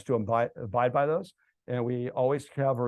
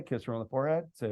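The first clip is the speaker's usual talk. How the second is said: ordinary delivery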